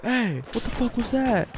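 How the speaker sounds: retold indignant demand; imitated confronting driver. Driver pulling up to Jacobo after the rock, imitated confrontation.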